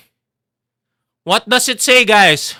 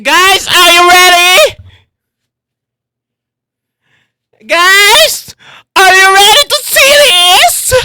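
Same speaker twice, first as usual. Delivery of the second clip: loud, raised hype-up call; excited yelling; 5 semitones higher, 6 dB louder than usual. Real, not acted. Streamer rousing viewers before reveal, yelling. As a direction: yell it higher and higher to the crowd.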